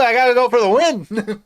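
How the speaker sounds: high, loud playful boast; mock triumphant lover. Friend voicing the lover's brag, high and loud. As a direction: crow it like a cocky winner.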